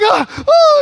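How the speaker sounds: high, loud cries of astonishment; amazed cries. Retold or imitated. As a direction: cry out, imitating a man amazed at his own legs. Amazed man jumping on healed leg.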